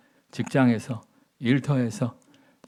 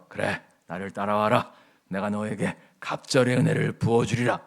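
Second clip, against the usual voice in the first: mock gentle prophet; 6 semitones lower than usual. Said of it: "이렇게 얘기해요?".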